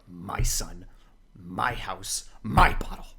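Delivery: rising from low to a loud angry shout; building angry yell. Retold or imitated. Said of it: "he's, like, yelling at him".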